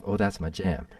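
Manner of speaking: ordinary talk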